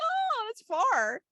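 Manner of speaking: high, slow, drawn-out exclamation; mock dismay. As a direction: stretch it way up like a shocked mum. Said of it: "I'd be like".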